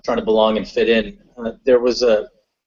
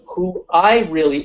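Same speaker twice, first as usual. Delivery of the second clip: normal talking voice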